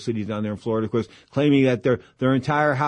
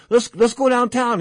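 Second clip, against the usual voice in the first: higher, hammed-up promoter urging; Don King voice; 10 semitones higher than usual. Don King urging Pedro downtown, in a higher hammed-up voice. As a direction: push it like the boss who decides. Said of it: "He goes".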